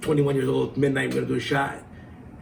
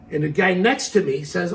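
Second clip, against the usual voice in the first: normal talking voice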